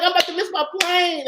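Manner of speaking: urgently explain, mildly frantic; mildly panicked plea. Retold or imitated. Traveler explaining to the man who helps, mildly panicked.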